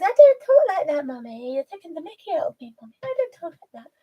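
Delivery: high, protesting animal character; indignant pet voice. Acted. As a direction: object to being mimicked, as a put-out pet. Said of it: "he looks, he goes".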